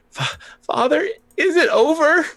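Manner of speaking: put-on girl clinging to her father after the battle, high and pleading; little girl's voice. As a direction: pitch way up into a tearful young girl.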